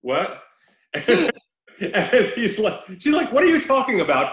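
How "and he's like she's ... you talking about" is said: high, loud words through laughter; laughing while retelling. Real, not acted. A podcast host retelling a bad movie, laughing. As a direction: laugh your way through the quote.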